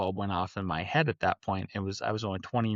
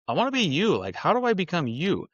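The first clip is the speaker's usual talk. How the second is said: put-on high, keen kid voice blurting it out; eager admiration; 7 semitones higher than usual. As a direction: imitate a starry-eyed teen telling his mentor. Teen self answering mentor eagerly.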